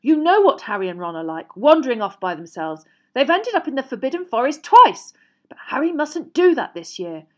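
worked-up parental worry; heated argument. A narrator's reading. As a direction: press the warning on a spouse. Parents arguing over their son's safety.

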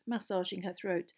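ordinary delivery

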